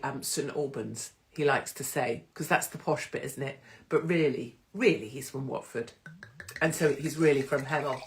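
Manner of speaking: ordinary delivery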